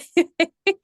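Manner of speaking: high burst of laughter; bright laugh. Real, not acted. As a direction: laugh out loud at the image. Laughing at leaky bucket joke.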